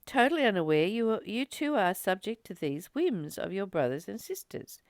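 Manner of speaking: usual speaking voice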